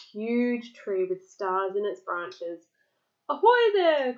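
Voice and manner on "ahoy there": loud, high sailor's hail; calling out. A narrator's reading. Unseen pirate hailing the captain, loud call. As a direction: holler it as if hailing a ship. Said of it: "called a voice".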